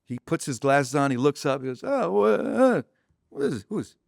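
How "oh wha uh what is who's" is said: high, broken stammering start; mock flustered confusion. Larry looks up at a stranger in his office, flustered. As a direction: fumble for the question, imitating bewilderment.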